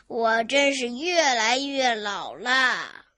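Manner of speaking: put-on slow, drawn-out old man's lament; sorrowful lament. Tired grandpa alone on the road, lamenting. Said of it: "他悲叹道".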